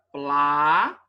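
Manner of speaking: "placa" split into a long pla, pause, ca; separação em sílabas. Shown as a demonstration. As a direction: hold the first syllable of "placa", then pause. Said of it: "quando eu for separar". ("placa" separado em sílabas: pla... ca)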